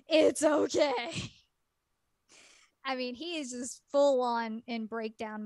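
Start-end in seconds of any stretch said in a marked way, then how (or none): 0.1-1.3 reassuring. Retold or imitated. She comforts crying boy.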